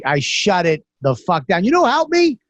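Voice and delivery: ordinary talk, nothing marked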